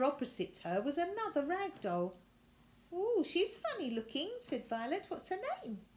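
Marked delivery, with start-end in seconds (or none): 2.9-4.3 put-on much higher girl's voice; bright child's voice; curious girl. Violet meeting the new rag doll, high child voice. 5.1-5.8 put-on much higher girl's voice; bright child's voice; curious girl. Violet meeting the new rag doll, high child voice.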